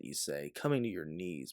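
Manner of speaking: usual speaking voice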